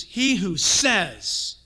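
"He who says" drawn out slowly, leaning on says; slow emphatic "He who says"; slow stress on says. Shown as a demonstration. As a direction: linger on the words "He who says". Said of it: "it doesn't matter what you say".

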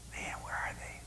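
hushed whisper, as if scanning around; whispered searching. Acted. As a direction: whisper it to yourself while hunting. God voiced searching the earth.

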